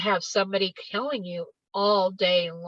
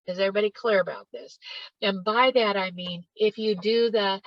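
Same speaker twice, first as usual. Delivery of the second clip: normal talking voice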